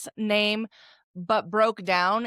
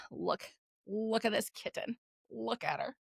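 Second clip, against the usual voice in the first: soft cooing aside; 2 semitones higher, 10 dB quieter than usual. Real, not acted. Showing the viewer her kitten.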